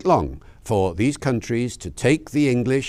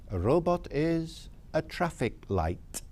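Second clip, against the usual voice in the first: put-on slow, softer South African accent; imitated South African accent, higher and drawn out; 4 semitones higher, 8 dB quieter, slower than usual. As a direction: spell out the answer slowly as a South African. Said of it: "says in a lovely South African accent".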